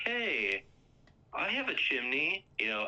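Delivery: mock-flirty friend showing himself off; eager friend. Friend pitching himself to women.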